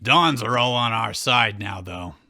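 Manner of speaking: deep put-on creature voice with a sly edge; voicing Pikachu. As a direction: growl it low, like a scheming little beast.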